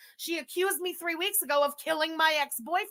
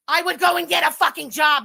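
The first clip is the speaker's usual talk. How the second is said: much louder contemptuous shout; scornful yell; 4 semitones higher, 9 dB louder than usual. Real, not acted. Streamer mocking a rival's life, loud scornful shout. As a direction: bark it out in disgust.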